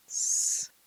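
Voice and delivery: sharp unvoiced hiss; a longer upset hiss; angry hiss. A narrator's reading. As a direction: hiss in frustration. Gollum more upset.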